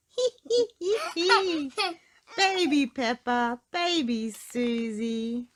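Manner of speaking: high giggles then slow teasing; childish giggling and teasing. A narrator's reading. Girls giggle about being babies. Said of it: "burst out laughing".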